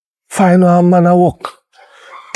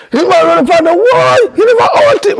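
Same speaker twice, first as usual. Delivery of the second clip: excited telling; 13 semitones higher than usual. Real, not acted. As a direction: tell it like a shocked outburst. Describing panic of fire.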